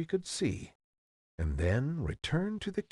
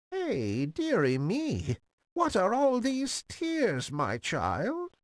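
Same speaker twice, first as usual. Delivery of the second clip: put-on high-pitched, drawn-out old crone voice; croaking old woman; 10 semitones higher, slower than usual. A narrator's reading. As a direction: croak it high and slow like a fairy-tale granny. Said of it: "in a feeble, croaking voice".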